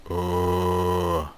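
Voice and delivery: drawn-out undead groan; zombie moan. Pretend. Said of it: "that's my zombie impression".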